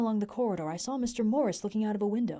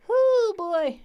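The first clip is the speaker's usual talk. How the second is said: excited whoop; 14 semitones higher, 10 dB louder than usual. Real, not acted. Streamer reacting loudly.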